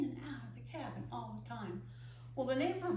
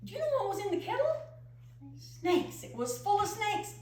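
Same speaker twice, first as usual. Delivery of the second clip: much higher, louder and fast; shocked reveal; 10 semitones higher, 6 dB louder than usual. Acted. Aunt revealing the kettle full of snakes, shocked and loud. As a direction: build to a startled outburst.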